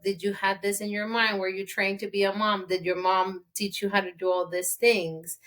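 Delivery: ordinary talk, nothing marked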